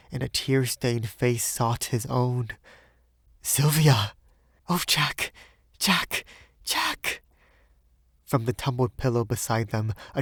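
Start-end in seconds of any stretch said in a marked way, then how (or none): 3.4-7.2 emotional cry. Acted. Reunion cries between lovers.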